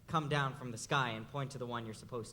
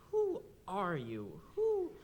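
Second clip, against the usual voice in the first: bewildered asking; 6 semitones higher, much slower than usual. Retold or imitated. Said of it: "you think to yourself".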